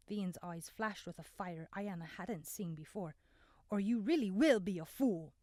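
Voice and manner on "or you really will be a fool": loud, firm warning; a hard, loud threat; stern warning. A narrator's reading. Vian warns the struggling Desmond, loud and stern.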